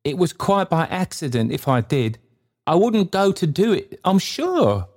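very high, pleading character voice; high-voiced, anxious apology; apologetic Trotty. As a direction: excuse yourself humbly, pitch climbing high.

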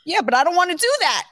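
fast, high, sulky protest; sulky whine. Retold or imitated. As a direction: whine it back quickly. Son sulking in retold argument.

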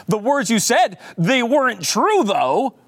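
mocking imitation of a prosecutor's gotcha question. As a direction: whine the question back like a fake gotcha. Commentator replays prosecutor's question.

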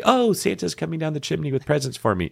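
mock excited. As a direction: play a giddy kid hearing Santa, lightly. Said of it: "They don't get all excited and say".

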